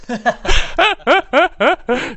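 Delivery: loud high burst of laughter; big laugh. Real, not acted. Laughing at the tour guide's line, loudly. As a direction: crack up at the joke.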